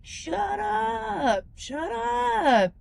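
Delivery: high, drawn-out imitation of a yell; mock yell. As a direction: mimic a sleeper yelling at noisy singers, without real volume. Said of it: "was yelling outside".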